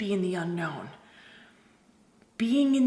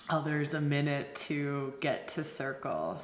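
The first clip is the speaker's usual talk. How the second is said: ordinary talk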